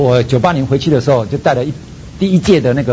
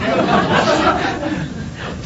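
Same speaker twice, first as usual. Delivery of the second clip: long breathy falling chuckle; sheepish laugh at his own fear; airy laugh; 12 semitones higher, 6 dB quieter than usual. Real, not acted. Laughing at hanging up phone.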